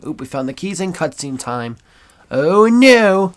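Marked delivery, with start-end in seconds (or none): 2.3-3.3 hammed-up gasp of alarm, higher and louder; mock alarm. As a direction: fake panic at the cutscene.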